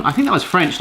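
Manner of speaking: ordinary talk, nothing marked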